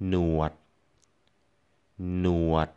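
หนวด on a low tone. Shown as a demonstration. Teacher modelling หนวด for learners, low tone. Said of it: "low tone".

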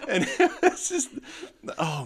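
very high laughing squeal. Real, not acted. Host still laughing about the wish spell.

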